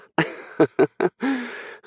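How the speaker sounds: high gleeful cackle at a rival; high mocking laugh. Hurricane laughs at Aspen, high and gleeful. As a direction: laugh right in her face. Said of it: "Hurricane just laughs".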